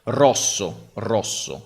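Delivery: rosso with a strongly trilled R; rolled Italian R. Shown as a demonstration. Showing how Italians say rosso with a trilled R. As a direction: roll the R hard in rosso. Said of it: "So we really say like".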